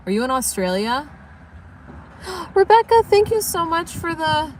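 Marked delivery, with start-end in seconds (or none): 2.2-4.5 thrilled, high-pitched gratitude; excited gasp. Real, not acted. Thanking a viewer for a donation.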